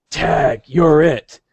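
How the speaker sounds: lightly put-on gruff cartoon hero; a hint of gruff menace, imitating a cartoon; Wolverine. Fan quoting Wolverine pinning Gambit, lightly gruff imitation. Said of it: "that kinda gruff sounding voice".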